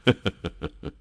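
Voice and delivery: soft chuckle of the Sun; a brief kindly laugh; the Sun laughing at the little drop; short laugh. Acted.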